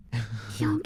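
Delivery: voiceless yonk in the dark; whispered yonk. Retold or imitated. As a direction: whisper it into the night. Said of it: "you just hear".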